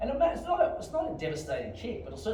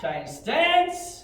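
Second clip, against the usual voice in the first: high, explosive counting yell; drill command shout; 12 semitones higher, 9 dB louder than usual. Real, not acted. Instructor calling kicks for students, shouting. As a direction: bellow the count to drive the class.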